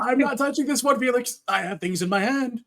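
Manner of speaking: mildly exasperated call down the hall; irritated refusal. Acted. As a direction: call back in exasperation with hands full. Acted irritated shout back to Felix.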